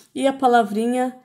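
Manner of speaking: usual speaking voice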